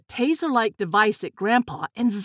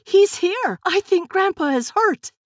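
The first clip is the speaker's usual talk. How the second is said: high, louder urgent cry; alarmed cry; 10 semitones higher, 4 dB louder than usual. A narrator's reading. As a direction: cry out the alarm, pitched right up. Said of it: "Tess cried".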